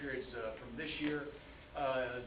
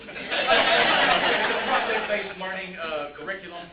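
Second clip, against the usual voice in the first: much louder, slower teacher voice with a laugh; 4 semitones higher, 13 dB louder, much slower than usual. Real, not acted. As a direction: project to the back of the classroom. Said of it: "my teacher voice".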